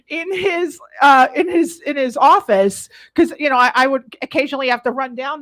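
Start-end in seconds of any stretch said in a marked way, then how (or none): none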